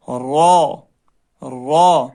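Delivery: را said full and heavy. Shown as a demonstration. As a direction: give را a thick, rounded sound. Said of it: "را کو کبھی پر پڑھیں گے".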